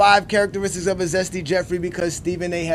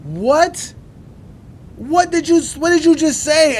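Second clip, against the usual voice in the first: high, loud outraged what; like he can't believe his ears; stunned disbelief; 10 semitones higher, 6 dB louder, slower than usual. Real, not acted. Reacting to the host's clip, stunned outrage.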